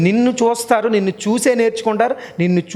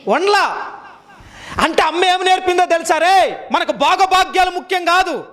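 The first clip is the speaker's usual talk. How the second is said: high-pitched, sustained sermon delivery; urgent exhortation held high; forceful preaching; 7 semitones higher than usual. Real, not acted. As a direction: preach it out, driving each clause home.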